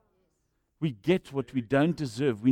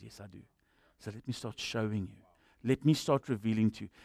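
soft God voice; 6 semitones lower, 9 dB quieter than usual. Retold or imitated.

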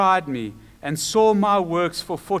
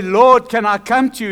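acted request; 5 semitones higher, 9 dB louder than usual. Contrasted wording of Peter's request.

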